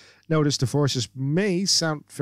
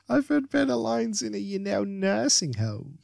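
high, squeaky put-down; squeaking; 7 semitones higher than usual. Acted. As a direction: deliver the insult in a high squeak. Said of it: "she squeaks".

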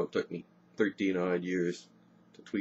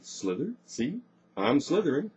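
high, rising teasing; mocking singsong snake game; playful taunt; 6 semitones higher than usual. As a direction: tease while pretending to slither. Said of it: "insulting sight of Brenna wriggling about".

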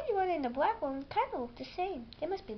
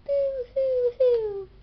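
high, slow, hammed-up sobbing; mock crying boo hoo; 10 semitones higher, 7 dB louder, slower than usual. A narrator's reading. Mother reading a bedtime book, fake crying. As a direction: fake a blubbering little dinosaur. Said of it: "One blue dinosaur cries".

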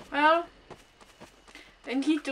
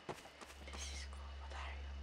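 whispering; 23 dB quieter than usual. Real, not acted. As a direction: breathe the words almost silently. Creeping through a dark room.

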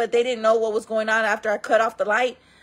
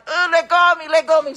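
pleading; 7 semitones higher, 5 dB louder, faster than usual. Retold or imitated. Caught in a headlock.

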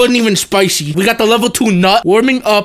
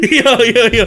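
normal talking voice

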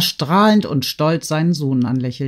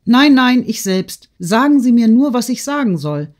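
put-on high, loud girlish insistence; worked-up daughter; agitated protest; 8 semitones higher, 5 dB louder than usual. A narrator's reading. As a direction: protest urgently, pitched well up. Agitated daughter protesting to her mother.

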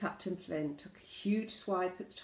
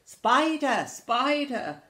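loud, high yelps of alarm; hammed-up panicked shouts; frightened cry; 7 semitones higher, 12 dB louder, slower than usual. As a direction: cry out in mock terror. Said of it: "cried the Gigantosaurus".

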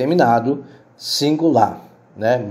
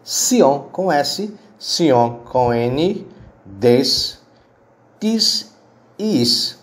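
spelled out; 2 semitones higher, much slower than usual. Shown as a demonstration. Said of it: "com a sílaba".

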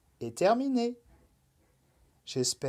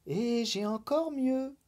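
mysterious; 9 semitones higher, 3 dB quieter than usual. Acted. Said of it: "ajouta la Nuit mystérieuse".

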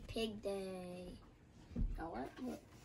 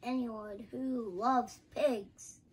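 emphatic declaration; 10 dB louder, slower than usual. Acted. Emphatic story climax.

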